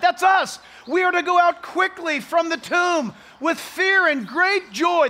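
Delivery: ordinary delivery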